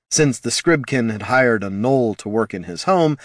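usual speaking voice

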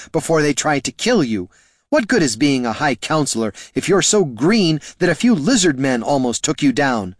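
angry shouting. A narrator's reading. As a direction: tear into him at full voice. Catha berates the wizard angrily.

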